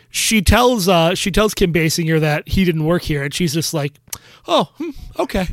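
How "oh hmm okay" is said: high-pitched imitation of a puzzled woman; small, high acted "oh"; mock mild surprise. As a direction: play her taken aback, then accepting. Acts out woman learning of deception.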